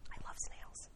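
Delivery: whispered confession, very soft; hushed aside. Real, not acted. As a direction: slip it in under your breath, whispering. Hushed confiding aside to the listeners.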